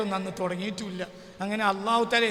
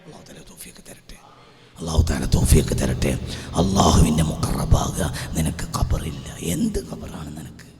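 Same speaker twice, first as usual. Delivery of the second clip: whispered prayer; 6 dB louder than usual. Acted.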